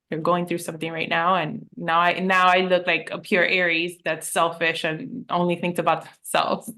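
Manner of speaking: usual speaking voice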